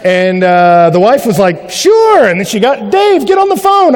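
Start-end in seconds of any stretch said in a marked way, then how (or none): 1.7-2.3 high-pitched bright sure; mock wife voice, high; high sweet yes. Wife answering on phone. 2.9-3.9 high, loud call to the husband; wife calling her husband, high and loud. Retold or imitated.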